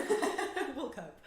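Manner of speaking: laughing it off, high and loud. Real, not acted. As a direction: laugh through the words. Guest laughs at joke.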